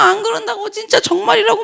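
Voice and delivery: high-pitched mock pleading; imitated begging. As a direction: plead that you truly mean it, pitched high. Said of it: "막 싹싹 빌고". (높은 톤으로 싹싹 비는 흉내)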